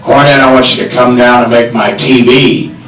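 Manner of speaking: low-pitched wrestling promoter impression; Buck Robley's voice. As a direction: drop the voice right down for the impersonation. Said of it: "he's got that voice down".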